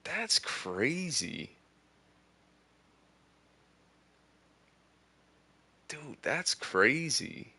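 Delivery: high, drawn-out "that's crazy"; real slow amazed exclaiming. Real, not acted. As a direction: savour the shock slowly. Reacting to in-game birthday gift.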